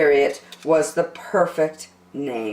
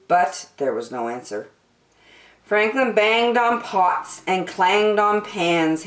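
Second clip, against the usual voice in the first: usual speaking voice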